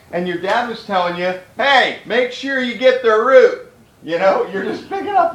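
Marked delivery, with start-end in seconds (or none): 1.5-3.7 higher, louder, slower fatherly call; dad's instruction. Retold or imitated. Dad telling kid in yard to get root, louder call.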